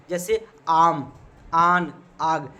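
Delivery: आम, आन, आग with the first vowel stretched very long and slow; long drawn vowel. Shown as a demonstration. Teacher stretching the madd vowel for students, slow and drawn out. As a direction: drag out the aa in आम, आन, आग. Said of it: "इसे खींच कर पढ़ा जाता है".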